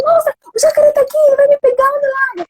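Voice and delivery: medo teatral, bem mais agudo e rápido, chorosa; fingindo medo. Pretend. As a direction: finja pavor para brincar com o filho. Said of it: "Tá, fui na dele". (mãe entra na brincadeira fingindo medo do jacaré)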